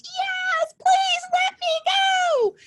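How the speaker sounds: high, slow crying plea to be let go; a trapped little devil begging, voice cracking high and loud; pleading little devil. Acted. Trapped little devil begs the blacksmith, high crying plea.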